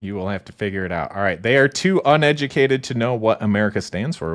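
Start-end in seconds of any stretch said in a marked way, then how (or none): none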